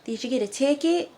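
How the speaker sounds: mock Southern mom firing questions; country mom. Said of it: "Mom was like".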